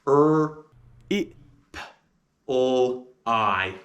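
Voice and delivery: er, i, p, l, I said one by one; sounding out reply. Shown as a demonstration. Teacher sounding out reply. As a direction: break "reply" into single sounds. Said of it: "And the sounds of reply are".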